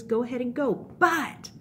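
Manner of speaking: lightly acted God giving firm orders; stern God. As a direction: lay down a firm condition. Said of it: "And so he says".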